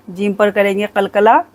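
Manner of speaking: ordinary talk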